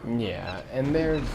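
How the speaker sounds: ordinary talk, nothing marked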